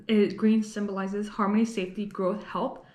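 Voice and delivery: normal talking voice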